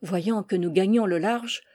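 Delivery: ordinary delivery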